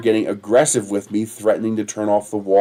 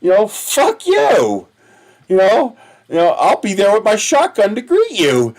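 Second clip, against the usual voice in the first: retold yell; 9 semitones higher, 3 dB louder than usual. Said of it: "I'm sitting here screaming at this lady".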